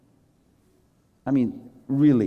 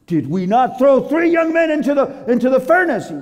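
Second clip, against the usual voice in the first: high, disbelieving question; alarmed ruler double-checking; astonished king; 11 semitones higher, 5 dB louder than usual. Acted. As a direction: pitch climbing in disbelief. King astonished at men in furnace.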